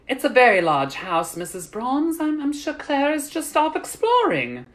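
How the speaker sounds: high, lilting reassurance; calming. A narrator's reading. Lady of the house calms frantic mother, reassuring. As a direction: soothe her without raising the volume. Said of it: "in an attempt to calm her".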